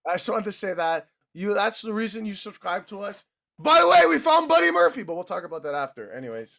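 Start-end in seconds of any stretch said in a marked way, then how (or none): 3.6-5.0 excited announcement. Real, not acted. Announcing surprise news.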